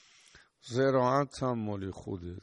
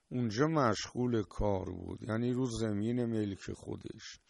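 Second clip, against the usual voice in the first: usual speaking voice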